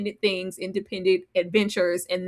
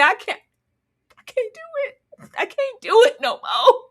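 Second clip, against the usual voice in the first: whiny breakdown; 12 semitones higher, 3 dB louder than usual. Retold or imitated. Boyd breaking down, retold.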